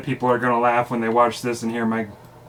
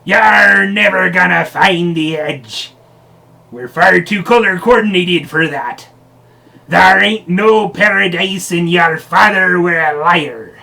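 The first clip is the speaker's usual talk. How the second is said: yelling pirate captain; 5 semitones higher, 6 dB louder than usual. Acted. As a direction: bellow it like a taunting pirate captain. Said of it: "He yelled to Captain Allegra".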